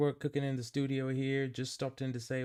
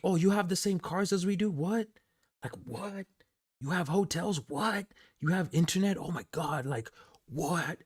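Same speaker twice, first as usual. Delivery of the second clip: higher mock disbelief, one question after another; imitating baffled dude; 8 semitones higher than usual. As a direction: play the clueless guy who can't believe it, lightly. Retelling the dude's disbelief.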